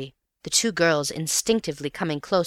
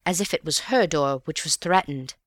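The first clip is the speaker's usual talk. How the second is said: ordinary delivery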